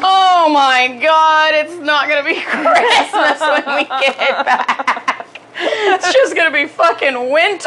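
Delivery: high, slow words broken by laughter; laughing. Real, not acted. To her cohost about winter, laughing. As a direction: laugh through the disbelief.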